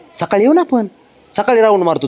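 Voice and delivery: high, sing-song promise; coaxing promise. Real, not acted. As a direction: bribe him with a morning ride. Bargaining with child.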